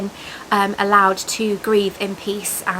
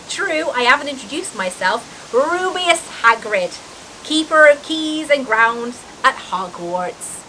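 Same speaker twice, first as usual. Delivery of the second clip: Hagrid; 3 semitones higher than usual. A narrator's reading. Giant introduces himself.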